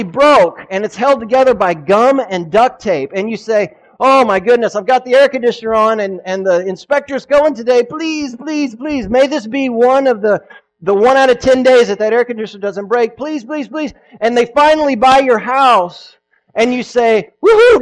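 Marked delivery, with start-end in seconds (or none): 4.0-13.9 anxious pleading seller. Retold or imitated. Seller pleading over broken AC. 17.4-17.8 high, loud triumphant whoop; hammed-up celebratory woohoo; mock whoop. Seller cheering after house sale.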